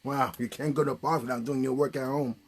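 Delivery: normal talking voice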